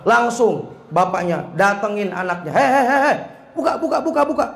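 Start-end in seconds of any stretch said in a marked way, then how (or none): 2.5-4.4 high-pitched mock urgent calling. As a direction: imitate a father demanding the door be opened. Father bangs on bathroom door. (menirukan bapak mendesak minta dibukakan pintu)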